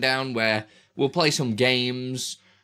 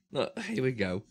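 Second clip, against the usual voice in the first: usual speaking voice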